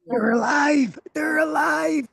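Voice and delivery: hammed-up mad scientist; mad scientist cry. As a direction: shout it high like Frankenstein. Frankenstein-style cry at animated plushies.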